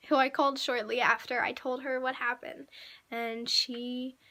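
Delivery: ordinary talk, nothing marked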